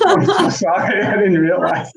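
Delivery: laughing at his own blunder; laughing apology. Retold or imitated. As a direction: apologize through embarrassed laughter.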